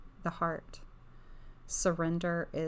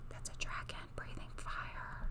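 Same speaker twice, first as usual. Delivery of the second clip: softer, hushed whisper; 7 dB quieter than usual. Real, not acted. Reader pointing out the dragon to viewers, whispered. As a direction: whisper it in awe, barely voiced. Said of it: "when I whisper".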